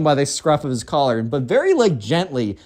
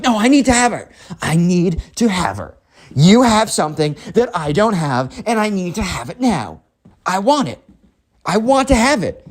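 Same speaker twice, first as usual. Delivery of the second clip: high, insistent bratty demands; demanding spoiled kid; 5 semitones higher than usual. Acted. Spoiled kid insists on having what others have, demanding. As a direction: demand it high, swooping on each phrase.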